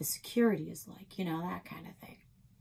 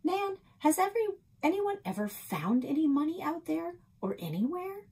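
put-on higher, eager young girl; 11 semitones higher, 3 dB louder than usual. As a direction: ask it like a curious kid. Young narrator voices eager girl.

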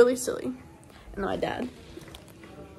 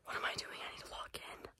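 whispering; 13 dB quieter, faster than usual. Real, not acted. Late night, quiet while others sleep.

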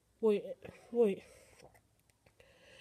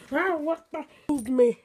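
loud, pitched-up startled outburst; 4 semitones higher, 8 dB louder than usual. Pretend. Player jumped at the opened door, startled yell. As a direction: yelp in shock. Attacked after opening the door.

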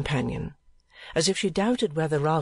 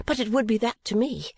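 with energy; 7 semitones higher than usual. A narrator's reading. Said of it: "said Kate with energy".

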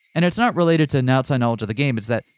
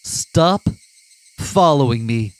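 stern command; 2 semitones higher, 5 dB louder, much slower than usual. Acted. Keiichi voiced reading scene.

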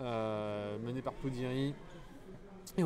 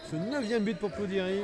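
excited goal call; 6 semitones higher, 5 dB louder than usual. Real, not acted. Goal call in live commentary.

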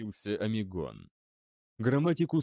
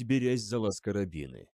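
ordinary delivery, nothing marked

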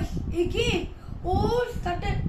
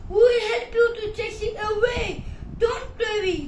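raised, higher-pitched call; shouting; 4 semitones higher, 6 dB louder than usual. Acted. Villagers promise the shepherd boy their help, shouting. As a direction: shout help back to him. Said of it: "they shouted".

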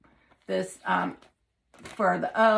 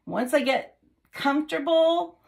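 ordinary delivery

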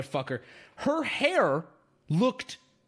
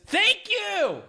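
excited thanks; 10 semitones higher, 4 dB louder than usual. Real, not acted. Streamer thanks chat excitedly.

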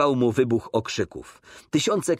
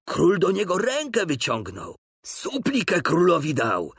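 raised voice; 7 semitones higher than usual. A narrator's reading. Onlooker speaking up about the soldier, raised voice. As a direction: pipe up from the crowd. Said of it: "rzekł jeden głośniej".

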